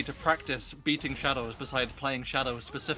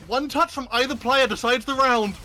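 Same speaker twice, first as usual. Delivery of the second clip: loud, high caster call; hype caster call; 9 semitones higher, 5 dB louder than usual. Real, not acted. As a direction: build the tension, go big. Tense final moment call.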